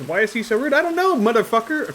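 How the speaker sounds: high, loud hammed-up rage; sudden pretend fury; mock outburst. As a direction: blow up at the rude monk for fun. Mock rage at rude game character.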